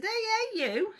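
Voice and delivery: high, loud playful "hey, hey"; teasing call. Retold or imitated. Calling out to Auntie Marg in the car, loud playful tease.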